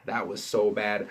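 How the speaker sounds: ordinary talk, nothing marked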